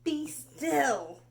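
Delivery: loud, firm command. Acted. Max taming the wild things, loud firm command. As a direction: order the monsters to freeze. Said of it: "Till Max said".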